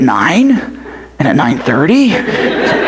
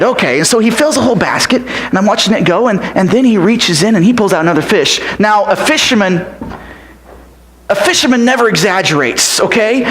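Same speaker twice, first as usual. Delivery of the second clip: usual speaking voice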